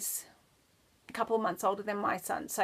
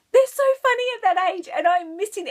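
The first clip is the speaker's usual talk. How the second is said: much higher, louder and faster gushing; excited gush; 9 semitones higher, 9 dB louder than usual. Real, not acted. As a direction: burst out with delight. Said again, bursting out after laughing.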